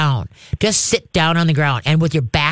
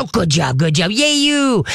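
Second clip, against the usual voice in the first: happy praise; 3 semitones higher than usual. Shown as a demonstration. Trainer praising the dog as it arrives. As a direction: cheer the dog on. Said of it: "you just gotta go".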